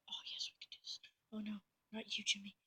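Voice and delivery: hushed mock whisper. Troops whisper hopes and fears for Jimmy. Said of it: "It's like".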